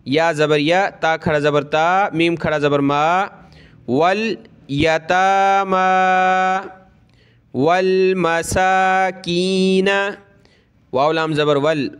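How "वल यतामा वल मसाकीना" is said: slow chanted recitation; teacher chanting the verse for students; Quran recitation. Shown as a demonstration. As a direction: recite slowly with a melodic lilt.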